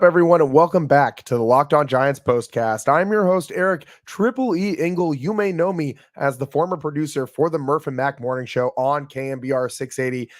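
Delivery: normal talking voice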